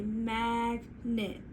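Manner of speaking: "magnet" drawn out as MAG... NET; slow, segmented "magnet"; stretched into syllables. Shown as a demonstration. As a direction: stretch "magnet" into two separate parts. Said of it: "We're gonna stretch it".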